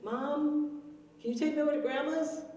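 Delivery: put-on high child asking mom; sweet, high kid's plea for a ride; little granddaughter. Granddaughter asks her mom for a ride, high child voice. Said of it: "this is the manager".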